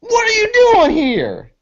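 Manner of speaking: high, outraged screech; crazed high-pitched indignation; freaked-out crackhead. Acted. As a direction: squawk it like a startled crackhead. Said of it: "And he's like".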